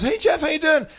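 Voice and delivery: high, lilting hello; cheery girl's greeting. Retold or imitated. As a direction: imitate her greeting in a high voice. Retold greeting from the girl.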